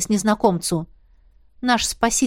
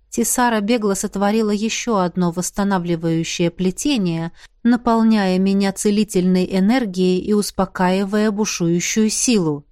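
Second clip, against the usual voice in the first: usual speaking voice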